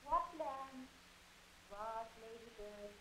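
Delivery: Lady Capulet heard from the wings; far-off voice projecting from the stage; distant stage voice. Acted. Play heard from backstage.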